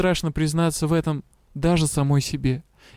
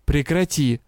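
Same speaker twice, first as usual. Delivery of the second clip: usual speaking voice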